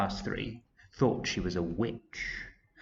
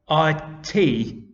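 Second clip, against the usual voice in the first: loud, higher-pitched faltering spelling; stammering; 6 semitones higher, 7 dB louder than usual. A narrator's reading. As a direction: falter through the letters, put on the spot. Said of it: "he stammered".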